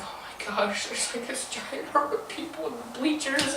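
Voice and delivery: very soft awed murmur; quiet retold alarm; hushed dismay. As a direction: murmur it to yourself. Retold awed thought at crowd.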